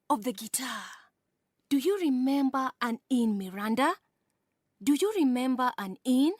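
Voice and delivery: rhythmic, lilting poetry reading; reading a poem aloud to a class with a swinging rhythm; poem recitation. Acted. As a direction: recite it with the beat of the dance. Teacher recites poem Tarantella.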